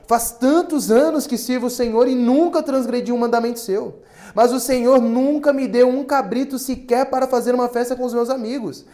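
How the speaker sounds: loud, high, resentful protest; reading the older son's outburst with rising anger; indignant older son. Acted. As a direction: pour out years of grievance. Older son's angry complaint read.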